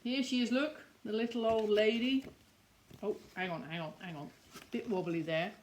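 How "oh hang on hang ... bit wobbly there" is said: ordinary delivery, nothing marked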